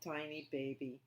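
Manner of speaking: usual speaking voice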